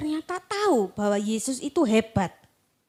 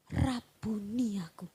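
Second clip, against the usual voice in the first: whispered plea; 3 semitones lower, 7 dB quieter, much slower than usual. Acted. Beggar's humble request.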